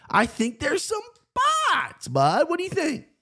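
slow, squealing falsetto; exaggerated hype. Host prodding co-host about picks, silly falsetto hype. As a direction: push it way up high and draw out "spots".

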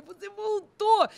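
high, drawn-out loving exclamation; squeezed with love. Retold or imitated. Saying it to God returned, high drawn-out adoration. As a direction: stretch it high, as if bursting with love. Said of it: "eu me exprimia de amor pra dizer pra ele".

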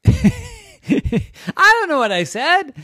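laughing, high and slow; storyteller laughing at her own story; real laugh. Real, not acted. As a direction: crack up mid-line.

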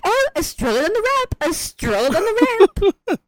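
high put-on exclamation; imitated excited witch. Witch spotting the stroller, imitated excitement. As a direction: mimic delight in a high voice.

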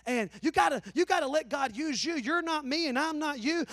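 normal talking voice

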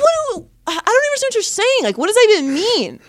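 high, fast, incredulous reply; retold bafflement. Storyteller baffled by the heckler's question, mock incredulous. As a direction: act out exasperated confusion, pitch jumping up.

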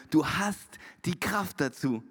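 DU HAST and KRAFT weighted, slow and low; low, deliberate weight on "Du hast die Kraft dazu"; emphatic repeat. Real, not acted. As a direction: slowly underline "Du hast die Kraft dazu", pausing after HAST. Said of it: "den ihr euch unterstreichen müsst".

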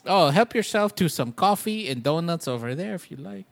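hammed-up host's accent, a little like Andy Kaufman; put-on accent. Mock teacher offering coffee and donuts to the class, in a hammed-up accent. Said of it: "I don't know why I did that accent".

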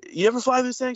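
high-pitched imitation of a film line; quoted movie character. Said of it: "he's like, uh".

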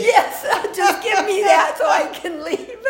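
high, loud, through laughter; laughing mock surrender. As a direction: play at giving in, laughing hard. Joking about her own timid shopping.

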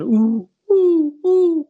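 high, loud hooting "ooh"; owl hoot. Retold or imitated. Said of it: "kind of a noise".

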